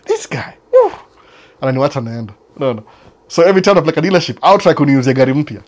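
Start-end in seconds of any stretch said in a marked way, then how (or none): 0.1-0.9 mock squeaky exasperation. Salesman muttering about him.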